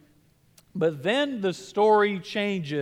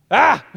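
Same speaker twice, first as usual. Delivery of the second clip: sudden loud frightened shriek; a short, loud mock-terrified "Ah!"; terrified scream; 6 semitones higher, 11 dB louder than usual. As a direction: shriek like a startled girl. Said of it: "she was terrified".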